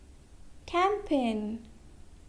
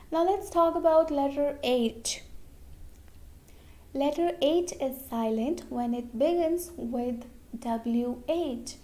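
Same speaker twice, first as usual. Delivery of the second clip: ordinary talk, nothing marked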